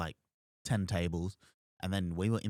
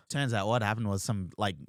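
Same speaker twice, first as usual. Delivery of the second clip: ordinary talk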